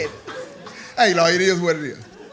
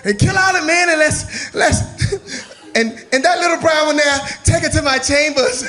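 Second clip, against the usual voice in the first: villainous captain; 7 semitones higher than usual. Acted. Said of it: "He said".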